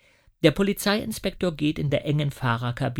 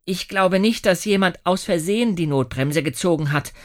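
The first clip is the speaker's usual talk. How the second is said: put-on slightly higher, louder boy; a boy reasoning it out; Lasse; 5 semitones higher, 5 dB louder than usual. A narrator's reading. Lasse voiced. (Junge, etwas höher und lauter)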